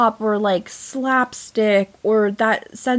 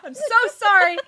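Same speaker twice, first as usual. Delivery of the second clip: mock tearful apology; 11 semitones higher, faster than usual. Mock apology through laughter.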